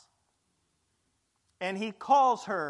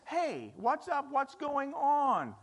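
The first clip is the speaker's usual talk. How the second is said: mock complaint; 6 semitones higher than usual. Sibling complains about doing all the work, mock whine. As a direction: whine that you're the only one working. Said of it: "there's always this".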